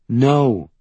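one firm, decisive word. A narrator's reading. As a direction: shut the idea down firmly. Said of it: "she says firmly".

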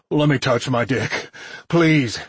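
strained begging, lifting on the last word; pleading. A narrator's reading. Submissive character begs for release.

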